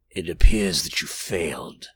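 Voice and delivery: low, sinister, rasping verdict. A narrator's reading. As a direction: pronounce the failure deep and raspy. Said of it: "in a low, rasping voice".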